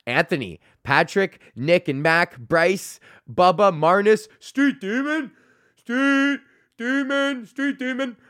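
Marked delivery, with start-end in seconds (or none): 4.4-8.2 high, drawn-out hype voice on the name; chanting a fan's name in a high silly voice; put-on high voice. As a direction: stretch Street Demon out in a high silly voice. Shout-out names in odd voice.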